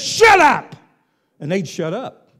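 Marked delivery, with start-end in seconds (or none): 0.0-0.7 loud, sharp rebuke, pitched high; Jesus shouting down the demons; commanding shout. Retold or imitated. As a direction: bark the order like a master silencing demons. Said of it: "He says".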